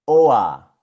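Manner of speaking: O A, the vocalized German or; German or sound. Shown as a demonstration. Teacher modeling German or sound. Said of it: "La O con la R suena O A".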